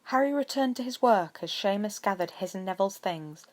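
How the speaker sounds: ordinary delivery, nothing marked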